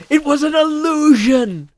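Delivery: high, very loud dramatic cry; Job. Acted. Streamer as illusionist to Michael, hammed-up loud outburst. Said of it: "I do an excellent Job impression".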